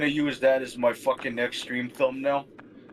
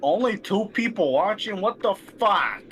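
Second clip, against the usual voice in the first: indignant disbelief; 7 semitones higher than usual. Real, not acted. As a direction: protest in high-pitched exasperation. Streamer complains about tiny audience.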